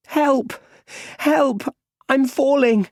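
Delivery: put-on high-pitched child's cry for help; panicked shout. A narrator's reading. Boy falling into a well, shouting in panic. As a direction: shout it in a small boy's very high voice. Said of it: "He's shouting".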